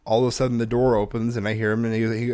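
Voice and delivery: ordinary delivery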